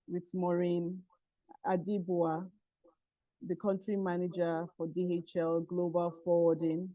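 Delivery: very faint voice, far from mic; weak, distant, hard to hear; barely audible. Real, not acted. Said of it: "your voice is very low".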